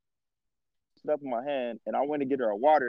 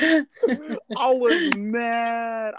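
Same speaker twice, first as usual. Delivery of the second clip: drawn-out mad; 7 semitones higher, much slower than usual. Real, not acted. Drawn out with laughter.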